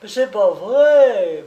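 beg, close to tears; distraught whimper. Acted. The hawker whimpers his denial, held in a crushing grip, distraught. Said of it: "pleurnicha d'un accent éperdu".

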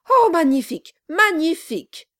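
high, loud gushing praise; acclaiming. A narrator's reading. Lawyer flatters the dwarf by habit, acclaiming. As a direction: cheer it out admiringly. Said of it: "acclama le procureur".

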